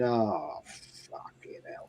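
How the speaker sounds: sinking into a whisper; dismayed inner curse. Retold or imitated. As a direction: breathe the curse out, voiceless and dreading. Inner dread at visible rival scarf.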